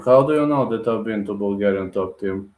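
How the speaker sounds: ordinary talk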